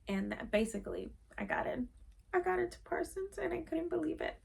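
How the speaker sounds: high, soft, tearful voice; choking out the good news through tears; crying. Real, not acted. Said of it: "I was not expecting to get emotional".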